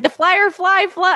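high, stumbling "Firefly fly"; botched tongue-twister. Retold or imitated. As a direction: trip over "Firefly fly" and break off. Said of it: "I said it wrong".

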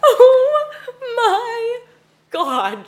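high squealing burst of laughter; laughing shriek. Real, not acted. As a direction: crack up loudly. Friend bursts out laughing at the phrase.

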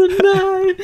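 crying. Retold or imitated. Told the code was wrong, high loud wail. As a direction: wail it in despair. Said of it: "hab so richtig geheult".

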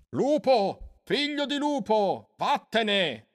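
very high, drawn-out banishing cries; shouting priest. A narrator's reading. Priest driving out the wolf-boy, shouting. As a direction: cry out high, as from a distance. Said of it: "gridò il sacerdote".

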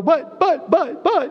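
high, stammering "but, but" like balking followers; mock protesting disciples. Disciples balking at Jesus's direction, high mock stammer.

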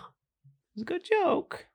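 high, slow, ironic self-praise; a hammed-up sing-song boast; mock proud. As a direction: drag it out high to fish for laughs.

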